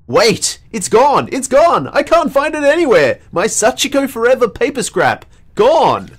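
frantic search, voice climbing; panicked. Acted. Character loses her charm.

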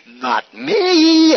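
high, squealed mock-innocent protest; hammed-up denial. Preacher voices Sarah denying she laughed, hammed-up innocence. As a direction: pitch it way up in a comic wail of denial.

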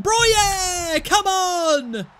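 real joy as the ball goes in; shouting for a goal, way up high; goal celebration shout. Real, not acted. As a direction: yell the scorer's name, high and loud.

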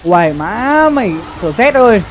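ordinary talk

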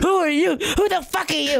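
high-pitched mock indignant demand; hammed-up indignant shouting; mock outrage. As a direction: pretend to be offended.

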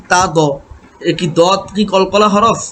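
usual speaking voice

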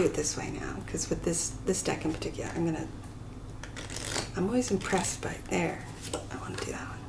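low quiet aside; muttering to herself. Real, not acted. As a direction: mumble it under your breath. Muttering while shuffling.

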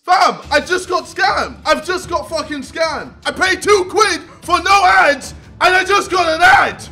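shouting that he paid for no ads; real furious rant. Real, not acted. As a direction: rage at being scammed by ads. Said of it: "I'm pissed".

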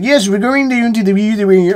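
mock the actress's odd Dutch accent; Dutch-sounding accent. Vlogger mimicking the British actress, light mock Dutch accent. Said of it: "like, Dutch or something sometimes".

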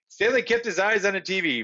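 plain reading voice

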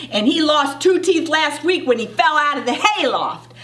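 plain reading voice

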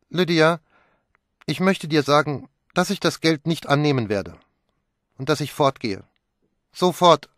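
high, loud and defiant; defiant outburst. Acted. Young woman telling Lydia she is leaving, high and defiant. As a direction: announce it like slamming a door. Pilar announces she leaves.

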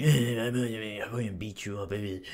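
dopey mock-brute babble; mocking Akaza. Demon mocking a rival who boasts of strength, babbling imitation. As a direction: imitate an annoying rival's boasting as meaningless blather. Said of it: "He's like".

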